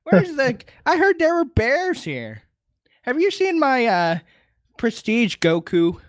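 put-on high, slow old-man voice; high, dragged-out geezer complaint; Master Roshi. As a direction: badger them like a doddering old coot. Voicing Roshi pestering the captain.

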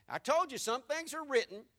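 ordinary delivery

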